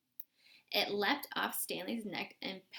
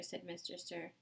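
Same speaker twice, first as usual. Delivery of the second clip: ordinary delivery, nothing marked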